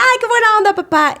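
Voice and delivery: a daughter squealing with mock delight at her father's joke. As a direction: gush at the joke like a happy daughter. Retold daughter reply, laughing.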